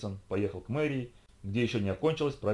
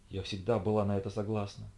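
ordinary delivery

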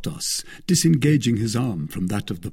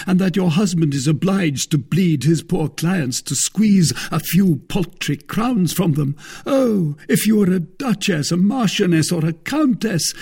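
higher, louder theatrical arguing; Porthos, indignant pleader; 8 semitones higher, 5 dB louder than usual. Acted. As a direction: press the case like an aggrieved suitor. Porthos argues for a loan.

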